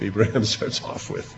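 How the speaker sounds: normal talking voice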